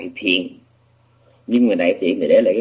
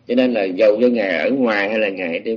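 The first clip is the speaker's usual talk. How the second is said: usual speaking voice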